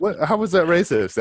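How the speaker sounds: high, loud indignant protest; indignant disbelief. Real, not acted. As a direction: protest in mock outrage. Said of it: "[name] will be racist about it".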